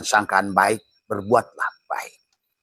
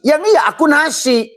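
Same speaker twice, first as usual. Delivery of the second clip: much higher and louder; voice of the rice; 5 dB louder than usual. Acted. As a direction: squeal it out high as the rice. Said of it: "kata nasi". (suara nasi sangat tinggi dan keras)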